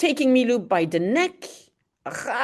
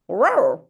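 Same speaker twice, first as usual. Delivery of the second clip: whispered mock bark; 4 dB louder than usual. Imitating Milou barking.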